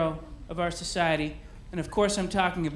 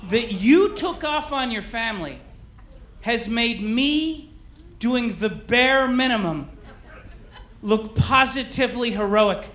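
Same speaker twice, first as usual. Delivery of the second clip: raised, drawn-out salute to an unsung hero; mock-solemn tribute; 5 semitones higher, 3 dB louder, slower than usual. Comedian honours deadbeat dads, in a grand mock tribute. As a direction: proclaim it like a grand dedication.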